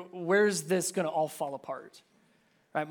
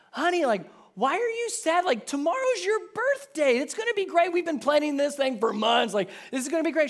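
much higher, coaxing and cheery; coaxing dad; 10 semitones higher, 5 dB louder than usual. Retold or imitated. Dad reassures his daughter, high cheery coaxing. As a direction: cheer up the sad child, high and bright.

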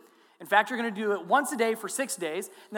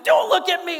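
very loud, much higher yell; drill sergeant yell; 9 semitones higher, 9 dB louder than usual. Retold or imitated. Drill sergeant screaming at the recruit, loud. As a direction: scream the correction. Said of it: "Can I yell at you?".

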